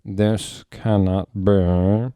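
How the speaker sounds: slow, loud, clipped disbelief; crisp voice. Acted. Snape refusing to believe it, crisply. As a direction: bite each word off. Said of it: "in a crisp voice".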